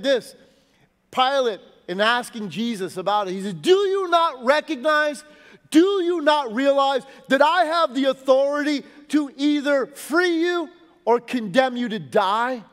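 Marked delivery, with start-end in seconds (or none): 3.6-12.6 loud, raised, threatening challenge; threatening demand. Retold or imitated. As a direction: lean on him like an angry governor. Pilate threatening Jesus.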